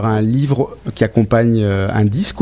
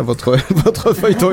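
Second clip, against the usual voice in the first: laughing; 6 semitones higher, slower than usual. Real, not acted. Host laughs introducing serial.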